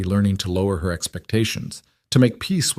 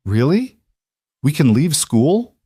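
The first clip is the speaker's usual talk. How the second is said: surprised; 6 semitones higher, 3 dB louder than usual. Acted. Child stunned by good news.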